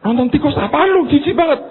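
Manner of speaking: high, squealing mock disgust; disgusted lady. Church lady mocks her friend, high mock disgust. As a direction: recoil at the rats.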